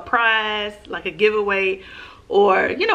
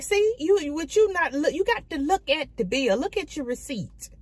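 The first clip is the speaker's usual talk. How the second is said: scolding; 6 semitones higher than usual. Retold or imitated. Retold scolding of server.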